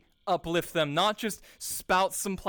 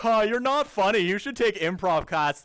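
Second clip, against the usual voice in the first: loud, higher mock jeer; taunting brother; 4 semitones higher, 6 dB louder than usual. Imitating his brother mocking his humour, loud taunt. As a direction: imitate a sibling's needling put-down. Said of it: "my brother hadn't constantly been like".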